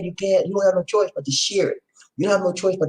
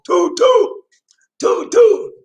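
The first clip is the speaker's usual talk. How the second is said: high, loud hooted toots; train whistle toots; 6 dB louder, much slower than usual. Pretend. Leading a call and response of toot toot, high loud train-whistle hoots.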